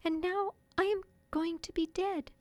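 high, gentle little fairy; tender, tiny dying voice; soft fairy voice. A narrator's reading. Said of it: "she told him softly".

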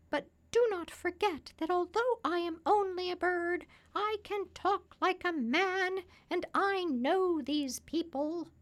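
high, loud parrot voice; high-pitched talking parrot; self-assured parrot. A narrator's reading. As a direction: answer as a confident high-pitched bird.

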